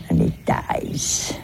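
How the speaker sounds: ordinary talk, nothing marked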